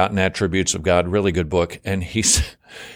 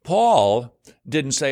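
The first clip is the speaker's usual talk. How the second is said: ordinary talk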